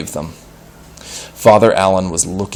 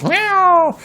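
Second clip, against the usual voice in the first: crowd of cats crying out. Acted. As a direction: cheer like a whole church of cats. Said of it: "exploded with cries of".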